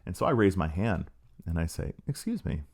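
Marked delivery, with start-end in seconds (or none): 2.0-2.6 soft, falsely meek "Excuse me"; mock humble. Professor retelling interrupting the speaker, mock humble and soft. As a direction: play it mock-meek and small. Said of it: "And I'm so humble".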